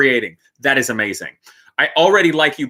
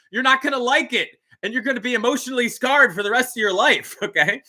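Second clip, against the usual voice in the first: hammed-up warning; 9 semitones higher than usual. Real, not acted. Joking warning about the tool truck.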